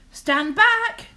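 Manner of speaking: high, loud warning shout; cry out. Acted. Mother warning her son as she chops, high loud shout. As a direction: yell a warning as the plant falls. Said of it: "she cried".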